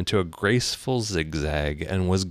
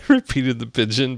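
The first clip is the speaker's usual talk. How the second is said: usual speaking voice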